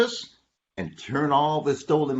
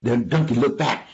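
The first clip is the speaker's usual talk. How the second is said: plain reading voice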